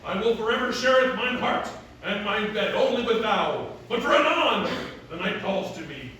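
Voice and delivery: loud, grandly declaimed vow; resolute masked hero. Acted. Hero swearing love to Selina but choosing London, declaimed. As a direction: proclaim it like London's protector. Acts the hero of his play.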